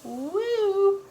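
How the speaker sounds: high, loud whoop; excited whoop. Real, not acted. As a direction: cheer the fizz. More vinegar foams.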